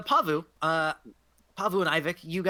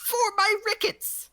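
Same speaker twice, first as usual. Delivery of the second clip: put-on old hag excuse. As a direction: squeal it out as an ailing hag. Hag invents an ailment.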